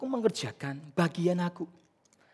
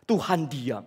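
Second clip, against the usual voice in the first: usual speaking voice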